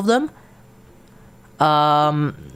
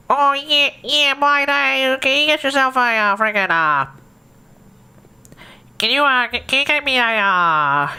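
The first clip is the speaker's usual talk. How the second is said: put-on Aussie druggo accent; 3 semitones higher than usual. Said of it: "You just have to have a rather nasally voice".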